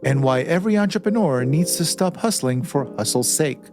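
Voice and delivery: polished host intro over music, landing each phrase; measured promo read with deliberate pauses; podcast intro read. Acted. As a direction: introduce the guest like a show opener. Host's scripted show intro over music.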